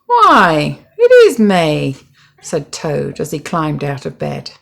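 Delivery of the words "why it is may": louder, astonished voice; amazed discovery. A narrator's reading. Toad amazed to see May on top. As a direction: discover it with wonder.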